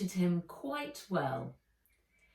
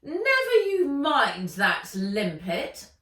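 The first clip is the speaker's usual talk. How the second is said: higher, louder triumphant sneer; gloating villainess, louder; Ivy, gloating; 6 semitones higher, 9 dB louder than usual. Acted. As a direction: brush him off with contempt.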